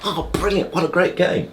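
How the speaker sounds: high, bursting with joy; thrilled post-match cry; excited footballer. Retold or imitated. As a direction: gush over the game, way up high. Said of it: "what are we gonna talk about".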